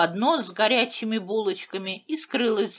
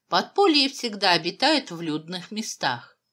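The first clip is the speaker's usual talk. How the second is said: usual speaking voice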